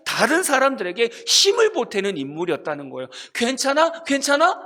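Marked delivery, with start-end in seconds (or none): 3.3-4.6 higher, louder, gently concerned asking; caring check-in. Pretend. Noah asking a struggling neighbor, caring and higher. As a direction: lean in and ask if they're okay. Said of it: "그렇게 해줬던 인물이었다는 거죠".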